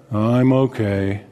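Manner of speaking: low, slow and mopey; gloomy Eeyore reply. Acted. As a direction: sigh it out like Eeyore, dragging.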